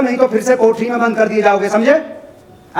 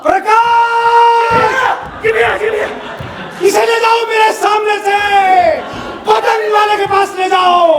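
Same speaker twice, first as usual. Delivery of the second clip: furious shout; 8 semitones higher, slower than usual. Acted. Father furious at the child.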